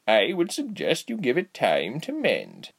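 put-on high, slow, swooping old lady voice; cheerful old woman. A narrator's reading. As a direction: draw it out sweetly like a cheery granny. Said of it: "she said cheerfully".

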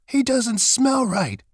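high, drawn-out rabbit exclamation; exclaiming rabbit. A narrator's reading. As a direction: object in a high storybook rabbit voice. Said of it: "he exclaimed".

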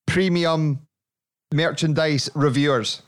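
slow grand announcer. Acted. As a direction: announce it like a big segment. Presenta la sección con pompa.

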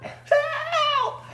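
very high, thin cry for help; squealed mock scream from down the hall; mock distant scream. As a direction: imitate a far-away scream, held back in volume. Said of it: "a blood-curdling scream from Sean".

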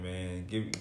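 normal talking voice

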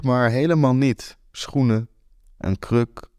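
ordinary delivery

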